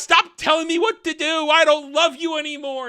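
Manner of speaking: high, loud petulant outburst; Celebrimbor. Retold or imitated. Smith breaking away from Sauron, mock tantrum. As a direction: protest like a hammed-up jilted lover. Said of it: "And he does".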